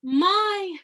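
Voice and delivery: "my" high and loud; high, distant placement. Shown as a demonstration. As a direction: throw "my" up and away. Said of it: "still place them in those places".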